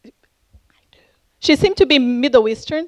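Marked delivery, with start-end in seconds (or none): 0.1-1.0 hushed, hesitant whisper with a pause between words; whispered reply. Retold or imitated. Shy cashier admits her belief to a customer, whispered. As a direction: whisper it, barely audible. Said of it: "so she s-, whispered".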